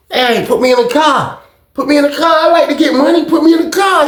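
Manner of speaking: high-pitched pleading demand; insistent demand. Retold or imitated. Jersey demanding in on the deal, high and insistent. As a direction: imitate someone clamoring to be included.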